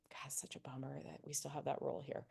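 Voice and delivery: confiding whisper. Shown as a demonstration. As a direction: lean in and whisper the sympathy. Confiding aside to patient.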